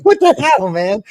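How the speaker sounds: high, loud mock astonishment; stunned outburst. Colleague hears the man admit lying, stunned outburst. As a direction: burst out in hammed-up disbelief.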